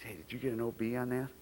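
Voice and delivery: hushed player voice. Retold or imitated. Backs asking each other.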